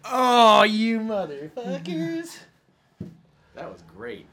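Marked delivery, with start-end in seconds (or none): 0.0-2.4 delighted outrage. Real, not acted. Reacting to a dramatic twist.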